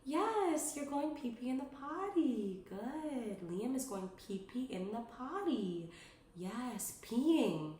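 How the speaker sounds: high, sing-song encouraging voice; lavish the toddler with gentle praise; cheerful praise. Shown as a demonstration. Mom praising her son for peeing in the potty, high and sing-song. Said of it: "the way that you're saying it is so important. Your voice, your tone of voice".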